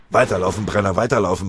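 loud, urgent yell from behind. A narrator's reading. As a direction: drive him on with a yell. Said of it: "brüllte von hinten Feldwebel Matischek".

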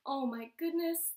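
ordinary delivery, nothing marked

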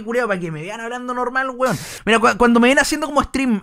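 usual speaking voice

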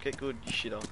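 ordinary talk

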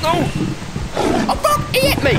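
very high, loud panicked cry; startled scream. Real, not acted. Gamer ambushed by Bigfoot, screaming high and loud. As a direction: scream as he gets hit.

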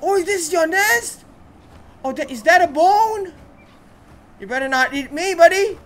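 high and loud, half-joking alarm; nervous excitement. Real, not acted. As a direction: nervously plead with the beast not to eat him. Finds creature nest, nervous joking.

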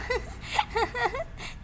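short high giggle; a pleased little laugh after a compliment; soft coy giggle; bashful giggle. Acted.

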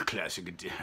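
ordinary delivery

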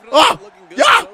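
high, loud cry; gleeful high exclamation; triumphant yell. Real, not acted. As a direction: whoop at landing a hit. Lands a hit.

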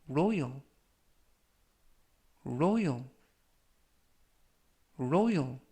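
"royal" three times, long pause after each; slow model reading. Shown as a demonstration. Teacher reviews vocabulary for followers, slow model reading. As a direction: read out "royal" slowly for learners to repeat. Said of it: "천천히 세 번씩 읽어드리고요".

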